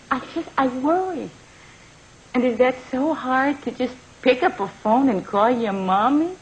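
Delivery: ordinary delivery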